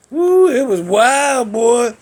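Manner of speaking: loud, high, drawn-out amazement; big hollered wow; whooping exclamation. Real, not acted. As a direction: whoop it, stretching the words. Exclaiming over woman's wild hair.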